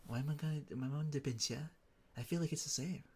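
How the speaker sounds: ordinary delivery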